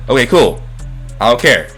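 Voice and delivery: ordinary talk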